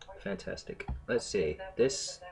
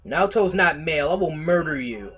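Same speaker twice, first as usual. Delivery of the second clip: loud, high-pitched mock outrage; mock threat; 8 semitones higher, 13 dB louder than usual. Streamer correcting viewers about a game character, mock threat. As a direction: threaten murder in exaggerated indignation.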